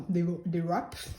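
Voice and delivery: wraps with a clumsy English W accent; soft, self-mocking English accent on "wraps"; attempted English accent. Shown as a demonstration. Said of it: "c'est magnifique avec mon accent".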